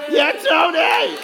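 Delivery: loud, high drawn-out cheer. Real, not acted. Priest cheering a boy in the crowd, loud and drawn out.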